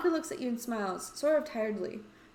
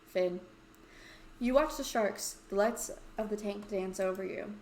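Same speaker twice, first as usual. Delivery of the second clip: normal talking voice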